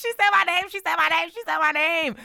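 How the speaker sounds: high, loud giddy repeating; excited. Real, not acted. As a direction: gush it like a starstruck fan. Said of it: "I was so excited".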